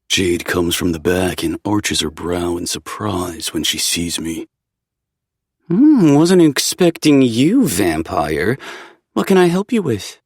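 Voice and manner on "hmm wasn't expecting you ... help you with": dry, teasing shopkeeper; shop witch voice. A narrator's reading. Jade's voice, narrator voiced.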